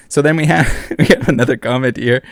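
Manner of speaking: ordinary talk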